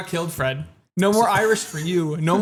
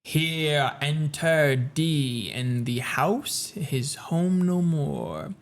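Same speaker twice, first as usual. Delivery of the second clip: very slow, low poetry reading; Nevermore-style gloomy verse, drawn out; slow, deep recitation; 3 semitones lower, 3 dB quieter, much slower than usual. Acted. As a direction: draw each line out deeply and solemnly. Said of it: "That was you just speaking slowly and deeply".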